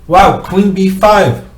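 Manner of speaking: sudden excited wow; delighted at finding the move. Real, not acted.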